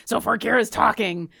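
lightly put-on character voice; demonstrating an in-character voice; Orkira. Shown as a demonstration. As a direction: slip into a player character's voice. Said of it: "I like having a voice for my character".

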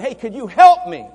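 loud, high shouted plea; a loud call for help in a crowd; shouting. Pretend. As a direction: shout it so the whole street hears. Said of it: "if I shouted".

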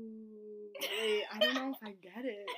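ordinary delivery

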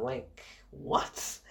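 soft unvoiced what in disbelief; whispered disbelief. Real, not acted. Reviewer reacting to Spider-Man cameo, whispered and stunned. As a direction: breathe it out, stunned.

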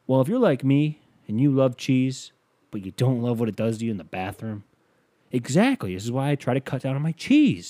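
second take of the ad read; commercial voice. Shown as a demonstration. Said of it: "take two".

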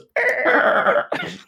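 high wheezing shriek; whinny with laughter; dying horse laugh. Real, not acted. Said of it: "Don't even know what that was".

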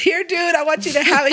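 imitating an excited friend, high and loud; retold burst of excitement. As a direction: blurt it like a thrilled friend handing over a gift. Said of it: "He was so excited".

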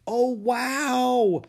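put-on high, loud girlish wonder; light up at the sight; amazed. Annie seeing the penguin ballroom, high and thrilled.

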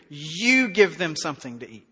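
You punched at the start of the line; stress on you. Shown as a demonstration. Preacher pointing the disciples at the crowd, You stressed. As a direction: lean hard on You, then let it fall. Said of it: "You is emphatic here".

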